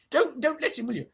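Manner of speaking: urgent dwarf, pitched high; Trumpkin. A narrator's reading. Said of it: "whispered Trumpkin to Peter".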